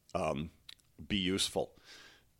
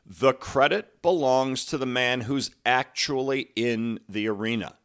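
emphatic quote reading; 3 semitones higher, 4 dB louder than usual. Acted. Coach reads the arena quote to listeners, emphatic recitation. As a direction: declaim it with measured emphasis.

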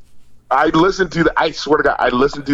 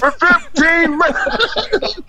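laughing; 11 semitones higher than usual. Retold or imitated. Retelling Mudbone routine laughing.

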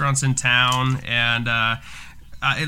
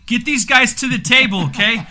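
higher Jersey waiter voice, slowing at the end; hammed-up Jersey accent; 5 semitones higher, 3 dB louder than usual. Host tells Vinny to seat the anniversary couple, hammed-up Jersey accent. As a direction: play the Jersey host bragging about the couple. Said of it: "it sounded like that".

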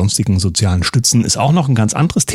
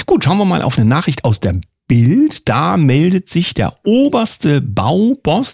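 ordinary delivery

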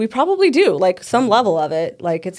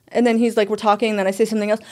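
ordinary talk

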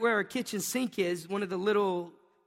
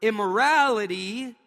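immorality said slowly, higher and loud; stressed immorality; 4 semitones higher, 8 dB louder than usual. Shown as a demonstration. Preacher hammering the word immorality for the congregation. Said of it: "and then the next word".

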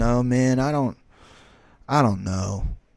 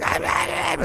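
raised-pitch Chihuahua yapping; imitated dog barking; 7 semitones higher, slower than usual. Storyteller playing the dog answering its owner, yapping. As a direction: mimic a little dog yapping back. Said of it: "The dog's like".

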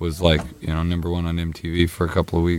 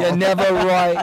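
nagging mom voice; 10 semitones higher than usual. Pretend. Mock nagging parent, laughing.

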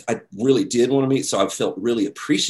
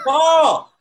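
much louder, higher call across the room; hollering; 8 dB louder than usual. Retold or imitated. Kelly calling Paul over to the narrator, hollering loudly. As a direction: holler his name across the lobby. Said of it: "hollering over to me".

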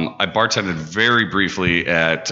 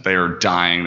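ordinary talk

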